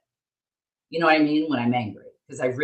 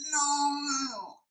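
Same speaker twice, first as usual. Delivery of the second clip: high, whining no; whiny kid no; 13 semitones higher, 6 dB quieter than usual. Retold or imitated. Mom imitating her son refusing sunscreen, whiny. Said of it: "he's like".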